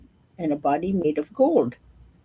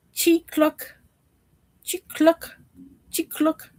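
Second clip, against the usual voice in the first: mechanical chirp; 9 semitones higher, slower than usual. Acted. Mechanical bird singing.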